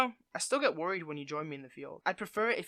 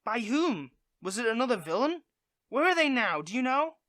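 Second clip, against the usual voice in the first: alarmed; 5 semitones higher, 6 dB louder than usual. Real, not acted. Alarmed questions about base.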